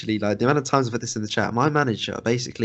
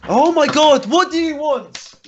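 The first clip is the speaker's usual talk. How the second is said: high, slow, drawn-out exasperation; exasperated outburst; 7 dB louder, slower than usual. Retold or imitated. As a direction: throw your hands up, whining high.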